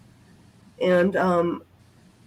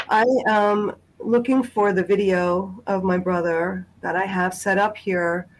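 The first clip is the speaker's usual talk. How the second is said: ordinary delivery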